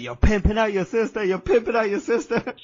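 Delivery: high, loud imitation of a furious father; dad losing it. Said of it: "losing his shit".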